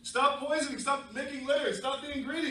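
high, loud scolding plea; dog's scolding. Acted. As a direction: cry it out up high. Dog scolding humans.

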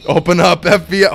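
loud, high police bust yell; mock-dramatic shouted command; imitated raid shout. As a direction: bellow it like agents at the door. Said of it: "they're just like".

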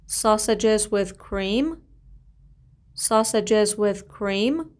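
very slow, word by word for learners; slow model reading. Shown as a demonstration. Teacher modelling a phrase slowly for students to repeat. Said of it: "بگین سوسیس با خامه".

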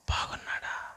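బాగున్నాడా breathed as a soft whisper; whispered echo. Shown as a demonstration. Preacher whispering the question back to the congregation.

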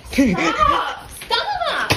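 laughing while tickled; squealing stop through laughter; being tickled. Real, not acted. Tickling her feet.

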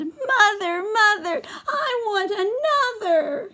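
put-on high sobbing baby voice; crying baby mouse. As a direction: wail like an unhappy baby. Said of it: "But Baby Mouse said".

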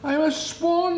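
high, loud happy swan voice; joyful cry. Acted. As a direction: cry it out in joy. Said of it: "he cried".

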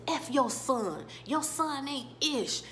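a hint of a robber taunting; mock contempt. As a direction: spit it at the father's picture. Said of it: "he says".

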